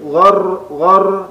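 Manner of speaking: "ghar" with the r pressed and doubled. Shown as a demonstration. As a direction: lean hard on the r of "ghar". ("ghar" ditekan pada ro bertasydid)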